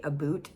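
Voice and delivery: "a boot" for about, with an oo vowel; joke Canadian "about". Shown as a demonstration. Host quoting jokes on Canadians, about as "a boot". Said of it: "joke around and say they say".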